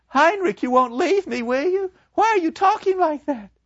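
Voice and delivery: put-on high, distraught girl pleading; a broken young woman begging her lover; Gretchen's high voice. To her lover, Gretchen gone mad with grief, high and pleading.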